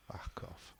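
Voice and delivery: whispered, barely voiced brush-off; muttered dismissal. Podcaster dismissing his own Brummie impression, whispered aside. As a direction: mutter it right under your breath.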